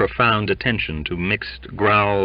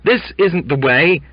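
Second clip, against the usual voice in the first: high, loud objection; clamouring protest; 9 semitones higher, 6 dB louder than usual. A narrator's reading. Younger men protesting to the captain, clamorous. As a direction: clamour against the order. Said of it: "clamored two or three younger men".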